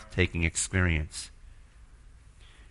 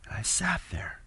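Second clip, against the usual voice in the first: hushed; 8 dB quieter than usual. Real, not acted.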